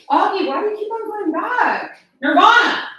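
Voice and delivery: high, loud exasperated calls; exasperated shouting. Real, not acted. Owner shouting at her cat and dog, exasperated high yelling. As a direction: shout at the pets, fed up.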